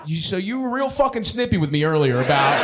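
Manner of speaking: ordinary talk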